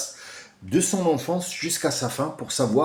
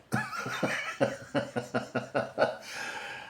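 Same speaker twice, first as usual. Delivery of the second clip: long breathy cackle in bursts; laughing; 3 semitones higher, 9 dB quieter than usual. Real, not acted. Speaker cracks up after naming Marine, laughing.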